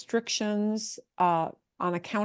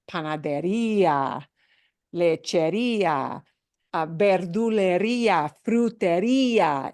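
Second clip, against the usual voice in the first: panadería, lechería, verdulería, frutería, each punched on the í; stressed ía endings. Shown as a demonstration. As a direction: lean on the í ending of each word in the list. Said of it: "we get so used to ia".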